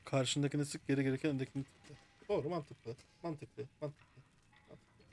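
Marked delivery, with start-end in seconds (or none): 3.2-5.0 fading mutter. Real, not acted. Musing, fading to himself.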